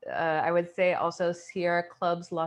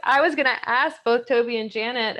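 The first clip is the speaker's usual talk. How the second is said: loud interjection; 5 semitones higher, 9 dB louder than usual. Real, not acted. Jumping in over others.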